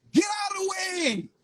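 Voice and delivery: high, loud cry; first call shouted hardest; shouted command. Acted. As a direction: yell it out to the crowd. Repeated command, first one shouted.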